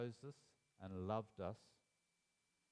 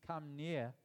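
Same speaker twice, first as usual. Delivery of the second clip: loud, higher yelled "Come near" from a distance; mock yell from afar; 6 semitones higher, 6 dB louder than usual. Shown as a demonstration. Preacher acting out yelling at God from a distance. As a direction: call it out like yelling at God far off. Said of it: "we yell at God from a distance".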